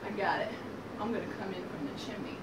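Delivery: low, soft plotting; quiet scheming. Acted. Wolf spotting the chimney, quiet scheming. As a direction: drop low and soft, as if hatching a secret. Wolf secretly hatches plan.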